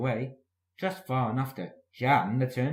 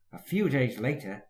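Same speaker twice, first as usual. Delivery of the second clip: ordinary delivery, nothing marked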